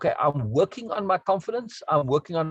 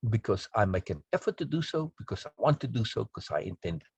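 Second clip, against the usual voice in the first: solemn creed recital; 6 semitones lower than usual. Shown as a demonstration. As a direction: read it like a vow on the wall. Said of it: "made a poster out of it to put up on their walls to say".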